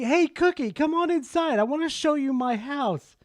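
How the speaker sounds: mock flirty lady calling Cookie in; luring woman. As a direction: coax him inside like a temptress. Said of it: "I heard her say".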